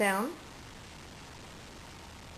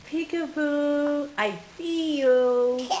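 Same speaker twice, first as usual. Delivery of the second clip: slow peekaboo; 4 semitones higher, much slower than usual. Pretend. Peekaboo game with baby.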